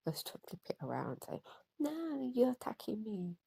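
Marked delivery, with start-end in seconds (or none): 1.8-3.4 high-pitched mock victim protest; mock-wounded accusation in a raised voice; playing the victim. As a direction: imitate someone crying victim, pitched well up. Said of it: "they'll play victim".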